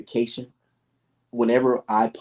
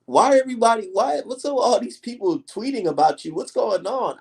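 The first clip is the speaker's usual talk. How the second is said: higher, lightly voiced girlfriend; imitating his girlfriend; 7 semitones higher than usual. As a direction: quiz him like his puzzled girl, pitched up. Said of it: "she'll be like".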